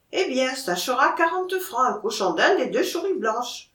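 Auvergnat peasant seller, s turned to sh; Auvergnat accent. Acted. Monkey seller names his price to the buyer, Auvergnat accent.

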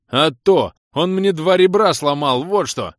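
loud, high outraged complaint; indignant. Acted. Влас accuses friend's father of breaking ribs, indignant. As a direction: flare up and shout the grievance. Injured caller complains angrily.

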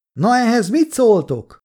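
high-pitched challenging shout; yelling. A narrator's reading. Kolja challenges the soldiers through the window, yelling. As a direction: yell it out of the window. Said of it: "ordított ki az ablakon".

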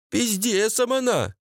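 high-pitched worker's exclamation; Abdulloh. Acted. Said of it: "сказал Абдулох". (высокий возглас гастарбайтера)

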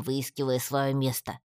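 ordinary delivery, nothing marked

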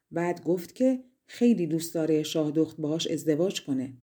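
ordinary delivery, nothing marked

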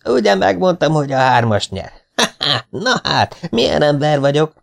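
high, gloating acted outburst; jubilant shouting. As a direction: crow about being proved right. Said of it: "kiabált az ősz".

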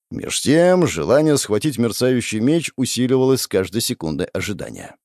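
usual speaking voice

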